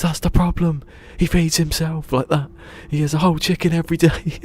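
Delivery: high, loud exasperated put-on voice; imitating the man. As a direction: squeal it out as the fed-up man. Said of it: "He goes".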